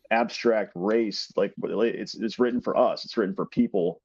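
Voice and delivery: ordinary delivery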